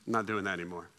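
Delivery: low, soft admission; acted sheepish backing-out, voice dropped; reluctant refusal. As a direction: let them down quietly.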